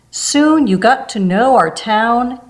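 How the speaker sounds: plain reading voice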